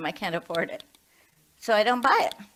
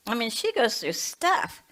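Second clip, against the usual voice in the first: usual speaking voice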